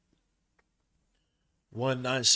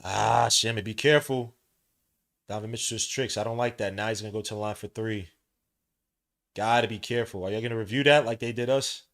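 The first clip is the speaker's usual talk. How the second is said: play-by-play; 3 semitones lower, 4 dB louder than usual. Acted. Streamer calling the Cavaliers game for chat, play-by-play. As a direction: call each move as it happens.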